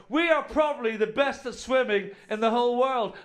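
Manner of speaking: high, loud national crowing; mock proud boast. Countries boasting about their swimmers, mock proud crowing. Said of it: "Most countries would be going".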